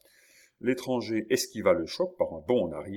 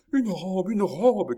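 high, loud, jubilant shout; joyful cry; 12 semitones higher, 7 dB louder, slower than usual. A narrator's reading. Chon clapping at the dress, crying out. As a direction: cry out the wonderful find. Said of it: "cria Chon en battant les mains".

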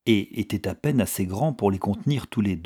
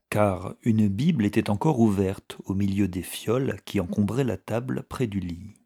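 usual speaking voice

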